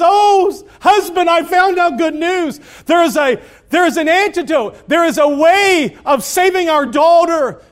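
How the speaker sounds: high, eager news to her husband; a mother bursting with hope; excited mother. Acted. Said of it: "She's excited".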